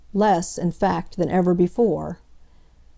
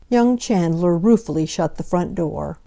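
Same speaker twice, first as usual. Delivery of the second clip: ordinary delivery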